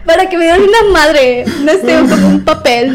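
correcting herself mid-laugh; laughing through words. Real, not acted. Laughing while correcting a slip.

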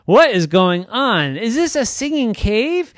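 high, loud alarmed questions; frightened. Acted. Girl lost in the cave, alarmed questions. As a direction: voice climbing in panic. Trisha freaking out.

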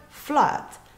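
flood with a short uh vowel; correct pronunciation. Shown as a demonstration. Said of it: "se pronuncia". (flood con vocal corta, correcta)

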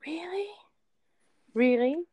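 high, rising mock incredulity; retold disbelief. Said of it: "And I was like".

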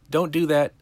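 a hint of a warning kid; half-hearted imitation of a kid's high warning; high-pitched kid. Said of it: "who has, like, a high-pitched voice".

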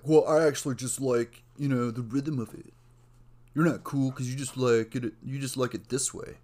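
loud, put-on music snob voice; mock contrarian. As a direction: imitate someone insisting on being different. Mimics people differentiating themselves.

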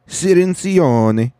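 mock Italian pronunciation of the name; Italian accent. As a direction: say Cirincione like an Italian. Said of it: "I'll do everybody's voice in their accent".

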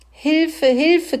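high, loud squealed cry for help; squealing cry. Acted. Pig squealing as the pony climbs in, loud and high. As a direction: squeal it again, desperate. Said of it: "quiekte er".

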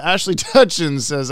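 ordinary talk, nothing marked

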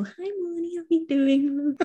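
put-on high, slow English-accented greeting to a friend; lilting English accent, drawn out; Maggie Smith impression. Maggie Smith greets her in the dressing room, English accent. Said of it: "I put an English accent on".

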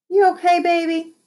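high, gentle worried question; motherly concern. Acted. Trotter comforting crying child.

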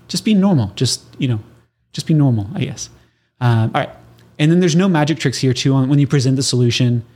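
usual speaking voice

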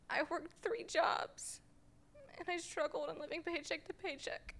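tearful whisper; choked up. Real, not acted. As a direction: push through the tears. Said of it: "I'm trying not to cry".

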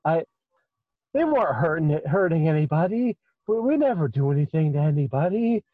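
plead, shaken and indignant; upset satyr. Acted. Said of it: "The satyr is just like".

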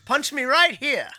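put-on high, loud English accent; mock British plea. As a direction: offer up your chin, loudly English. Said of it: "joining the British bit".

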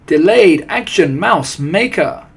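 high, loud, drawn-out chorus of awe; excited witches' cry. A narrator's reading. Witches echoing their leader's formula name, crying out excitedly. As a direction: cry it out slowly in thrilled amazement. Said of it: "they cried".

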